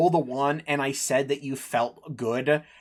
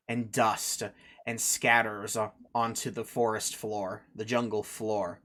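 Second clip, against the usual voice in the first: low, measured dramatic storytelling; game master narration; 3 semitones lower, 4 dB quieter, slower than usual. Acted. Game master telling the player how the skeleton falls, solemn narration. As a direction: let each phrase land with solemn weight, softening at the end.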